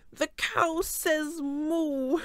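slow, drawn-out megaphone blare; blaring megaphone. Acted. Coach's rigged megaphone blaring, slow and booming. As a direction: let it boom like a broken megaphone. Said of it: "the megaphone blared".

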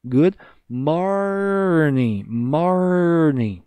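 morning ending without the G, very slow; dropped G. Shown as a demonstration. Teacher showing the silent G, drawn out. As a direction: say morning with no final G sound. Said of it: "Não vamos pronunciar o G".

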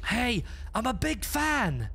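high-pitched fanboy call; playful, high fan voice; mock fan gushing. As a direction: hail them like a starstruck fan, high. Gushing at a ghostly figure.

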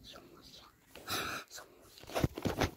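slow, very soft whisper; barely audible, drawn-out alert; hushed warning. Real, not acted. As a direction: whisper it as if hiding from someone. Enemy nearby in the game.

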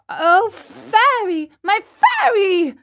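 high, loud, drawn-out plea; crying out. A narrator's reading. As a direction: call desperately for the fairy. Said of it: "the marionette cried out".

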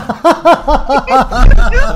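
high cackling laughter; loud laugh. Real, not acted. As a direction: crack up loudly. Videoya gülme krizi. (yüksek kahkaha)

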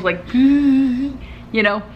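stifled giggle, slow and high; muffled giggle. Retold or imitated. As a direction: giggle you're trying to hold in. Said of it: "She's doing one of those muffled giggles".

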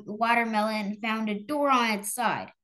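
ordinary delivery, nothing marked

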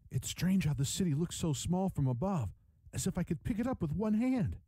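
put-on high, light boyish voice; young boy. A narrator's reading. Boy marvels to his father at the small city, high and boyish. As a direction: voice a wondering boy, pitched well up. Boy character speaking, higher voice.

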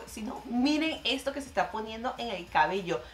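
usual speaking voice

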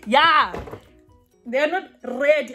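ordinary talk, nothing marked

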